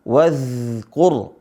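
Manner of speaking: "wazkur" with a long continuous dh before kur; sustained voiced friction in "wazkur", no bounce; flowing za. Shown as a demonstration. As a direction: let the z in "wazkur" run smoothly. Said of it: "dibaca dengan mengalir".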